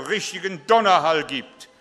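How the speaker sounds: booming sudden shout like a thunderclap; thunderous shout. Shown as a demonstration. Pastor booming at the congregation to show thunder, loud shout. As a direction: roar it out to mimic the thunder. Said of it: "das war zu laut".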